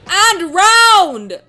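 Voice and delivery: loud, high protest, drawn out; indignant shout. Real, not acted. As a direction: shout it in playful outrage, stretching the words. Indignant protest at Pokemon comparison.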